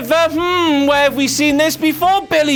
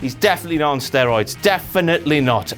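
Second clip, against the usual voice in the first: sarcastic mutter; 7 semitones lower, faster than usual. Acted. Sarcastic denial repeated quickly.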